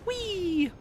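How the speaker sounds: high gleeful squeal; gleeful whee. Real, not acted. Streamer sliding down a vent, squealing with glee.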